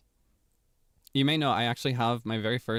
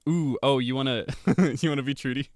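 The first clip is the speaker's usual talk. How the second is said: usual speaking voice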